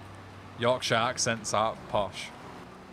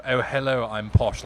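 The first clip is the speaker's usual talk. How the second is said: loud, mock upper-class British greeting; posh British accent; 6 dB louder than usual. Shown as a demonstration. Streamer explaining posh to chat, mock upper-class accent. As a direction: put on a proper posh accent, loudly. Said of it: "Posh is, like, proper".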